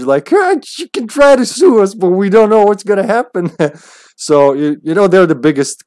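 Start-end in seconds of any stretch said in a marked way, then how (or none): 0.3-3.5 high-pitched, put-on polite voice; mock corporate nice. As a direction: imitate a company being sweetly unhelpful. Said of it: "all in nice language".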